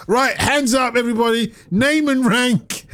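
hammed-up invader demanding names; German soldier barking orders. Retelling Dad's Army, mock German soldier orders. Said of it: "they're like".

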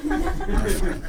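squeaky mock voice. Comedian squeaking a silly echo of his own line.

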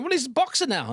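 a lightly voiced aunt breaking the news; voicing the auntie. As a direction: play the gossiping aunt lightly, high up. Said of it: "So Auntie's going".

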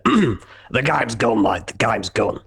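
slow, gravelly Australian manager voice; Ange Postecoglou. Retold or imitated. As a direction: drag it out like a weary Aussie boss. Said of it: "as Ange Postecoglou would say".

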